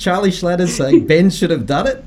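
high-pitched astonished questioning; incredulous. Real, not acted. Host stunned by Charlie saying Ben should have done it, incredulous. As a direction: say it back in disbelief. Host reacts to surprising admission.

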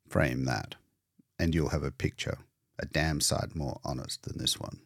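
put-on low, sardonic old man's grumble; gloomy old painter. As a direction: scorn for the orderly, low and soft.

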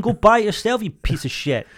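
heated mock rant; angry. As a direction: spit it out, fired up. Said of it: "because I'm boiling".

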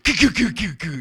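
high, loud imitated clunking noise; mock machine noise, loud and high; mower clunk imitation. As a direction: mimic the rock rattling in the mower. Imitating mower hitting rocks.

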